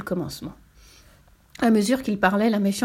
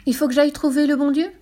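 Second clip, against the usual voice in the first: higher, rising question; small devil taken aback, pitched up; astonished little devil; 6 semitones higher, 4 dB louder than usual. A narrator's reading. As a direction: ask in wide-eyed disbelief.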